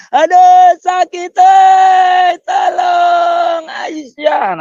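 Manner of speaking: loud, high drawn-out wail for help; mock pained screaming. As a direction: imitate a dying man yelling out in pain. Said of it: "sambil dia teriak". (teriakan kesakitan yang dibuat-buat, melengking panjang)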